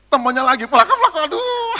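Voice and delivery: high, loud laugh through the words; laughing mock complaint. Pastor hit again by a worshipper, laughing loudly. Another person hitting him.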